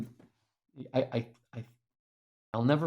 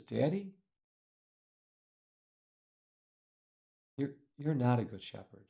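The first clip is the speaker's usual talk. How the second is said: put-on tearful child; 7 dB quieter than usual. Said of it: "with tears streaming down her face".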